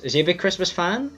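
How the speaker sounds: normal talking voice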